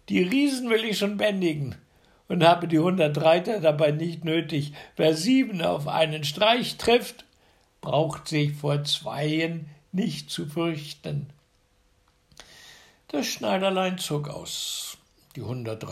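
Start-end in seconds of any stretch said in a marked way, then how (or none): 0.1-11.3 loud, bragging, higher and fast; boastful tailor. A narrator's reading. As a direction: brag about the giants. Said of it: "gab er zur Antwort".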